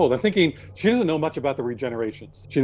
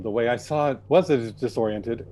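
usual speaking voice

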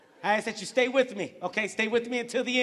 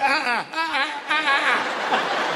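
loud, high repeated "uh-uh"; mimicked uh-uh; 5 semitones higher, 4 dB louder, slower than usual. Comic now acting like the attendant, loud mimicked uh-uh. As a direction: insist with raised, slowing uh-uhs.

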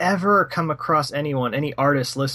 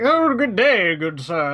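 higher, hammed-up toff voice; mock posh British greeting; 5 semitones higher, 4 dB louder, slower than usual. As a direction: play a fake British gent. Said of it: "fat, dopey American people that try to come off like".

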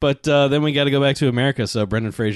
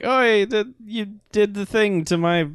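higher-pitched imitation Italian accent; mock Italian accent; 10 semitones higher than usual. Imitating the vineyard owner for his friends, mock Italian accent. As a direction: put on a hammy Italian accent. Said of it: "he's saying it in Italian".

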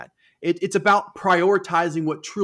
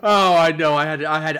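stifled giggles; 4 dB louder than usual. Real, not acted. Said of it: "I was trying not to laugh here".

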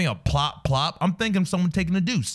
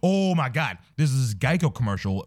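normal talking voice

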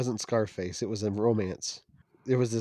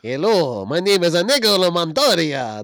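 put-on failed French accent that comes out Spanish-sounding, louder; attempted French accent; 7 semitones higher, 9 dB louder than usual. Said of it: "That still sounds Spanish".

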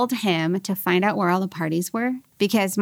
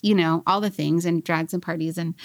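ordinary talk, nothing marked

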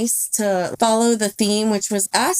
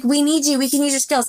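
pitched-up imitation of her coaxing; lightly voiced pleading mercenary; mock Lady Mercenary; 6 semitones higher, faster than usual. She mock-coaxes the pilot, pitched up. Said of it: "and is like".

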